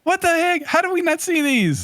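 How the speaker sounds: genuinely floored by the news; high-pitched real astonishment; surprised. Real, not acted. Shocked at obscure movie news.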